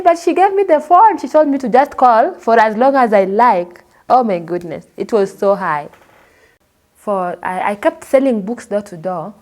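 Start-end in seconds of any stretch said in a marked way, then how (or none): 0.0-3.8 indignant protest. Retold or imitated. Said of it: "I said".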